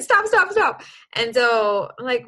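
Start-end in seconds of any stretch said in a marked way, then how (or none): 0.0-0.8 higher and louder "stop, stop, stop", half-laughing; mock scolding of superstitious parents; retold stop. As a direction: wave off the bad omen with a raised voice. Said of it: "And then we were like".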